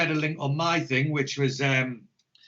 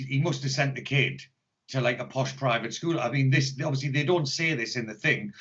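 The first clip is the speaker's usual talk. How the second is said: usual speaking voice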